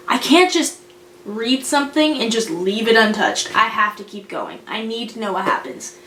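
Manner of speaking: usual speaking voice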